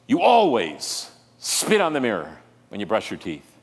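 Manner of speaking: the nagging partner's jab; mocking accuser. Preacher mimics a spouse's petty accusation, sneering.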